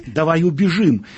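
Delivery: ordinary delivery, nothing marked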